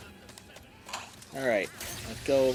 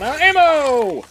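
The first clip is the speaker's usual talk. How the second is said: shouting; 8 semitones higher, 9 dB louder than usual. Real, not acted. Yelling while out of ammo.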